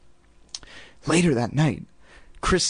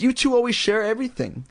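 high-pitched silly mother voice; silly mom; 9 semitones higher than usual. A narrator's reading. Mother pushing the sisters to share, silly. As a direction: pitch it way up, cluelessly. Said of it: "Missus Powell continued".

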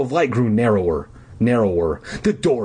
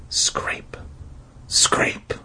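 soft unvoiced whisper; 5 dB quieter than usual. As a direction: breathe the word like a creeping sound. Narrator mimicking scraping sound.

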